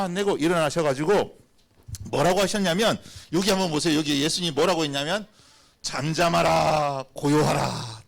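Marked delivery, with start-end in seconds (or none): 5.8-8.0 slow, drawn-out command; solemn slow command. Acted. Preacher quoting Jesus to the storm, slow solemn command. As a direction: stretch each word out, measured and firm. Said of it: "딱 이렇게 말씀하신 거예요".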